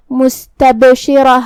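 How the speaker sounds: مستبشرہ slowly recited with the ب bounced in qalqala. Shown as a demonstration. Tajweed teacher demonstrating مستبشرہ with qalqala on ب. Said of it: "با کو ہلاتے ہوئے یہ کلمہ کو ادا کرنا ہے".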